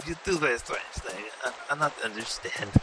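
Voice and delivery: put-on broken English in a heavy Russian-style accent; bewildered Russian-accented gamer; mock Eastern European accent.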